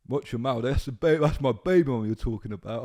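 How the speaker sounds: a hint of a boss squaring up to him; mock angry warning. Boss confronts him in the face.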